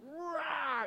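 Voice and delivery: high, weak little lion-cub roar; kitten-like roar. Acted. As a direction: try to roar, out comes a kitty. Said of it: "that sounds like a little kitty".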